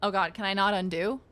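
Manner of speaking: normal talking voice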